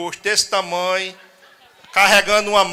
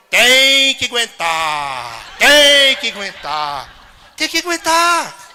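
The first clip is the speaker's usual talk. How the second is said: very slow tolling chant, last toll higher; bell chanting; 3 semitones higher, 4 dB louder, much slower than usual. Retold or imitated. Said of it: "O padre dizendo". (o sino badalando devagar: tem que aguentar)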